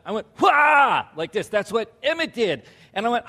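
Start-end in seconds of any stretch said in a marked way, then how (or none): 0.4-1.1 sudden high, loud "wah"; loud scare shout. Retold or imitated. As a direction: act out the startling yell at full volume. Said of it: "I don't lower my voice".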